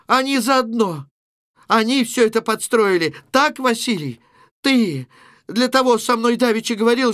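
high, loud, sustained outcry; suddenly loud. Acted. Natasha accusing her sister and Vasily, suddenly loud. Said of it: "Наташа вдруг громко".

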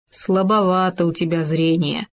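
usual speaking voice

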